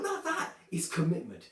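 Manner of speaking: ordinary talk, nothing marked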